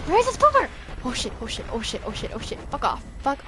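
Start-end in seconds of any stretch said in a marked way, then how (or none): 0.1-0.7 frantic high cry. Real, not acted. Frantic search.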